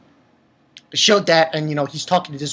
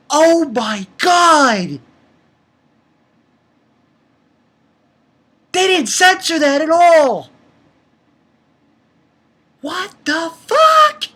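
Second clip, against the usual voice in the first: loud, high, drawn-out disbelief; stunned fan yelling between long pauses; shocked outcry; 11 semitones higher, 7 dB louder, slower than usual. Real, not acted. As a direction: shout each phrase as if appalled and thrilled. Reacting to uncensored scene.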